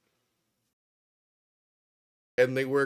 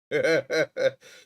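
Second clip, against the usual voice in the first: burst of laughter; 5 semitones higher, 8 dB louder than usual. Real, not acted. Laughing at own story.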